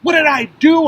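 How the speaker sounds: high, loud frantic yell; yelling in confusion at the police. Retold or imitated. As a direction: cry out in panic, not understanding. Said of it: "I yell".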